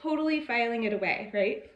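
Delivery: usual speaking voice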